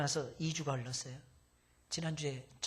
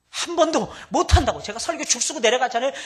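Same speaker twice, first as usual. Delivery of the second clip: loud; 8 semitones higher, 14 dB louder than usual. Acted. Preacher jokes loudly.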